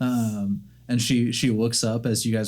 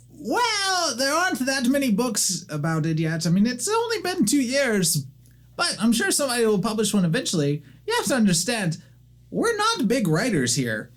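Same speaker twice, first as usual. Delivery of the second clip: very high, hurried panicky voice; young gnome caught without pants, flustered; 14 semitones higher than usual. Acted. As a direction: scramble to stall the visitor.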